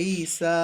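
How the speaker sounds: slow "ঈসা", both vowels drawn out; stretched recitation-style "ঈসা"; long-vowel recitation. Shown as a demonstration. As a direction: hold the ee, then the aa in "ঈসা". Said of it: "খাড়া জবর হিসেবে পড়ে ফেলবো".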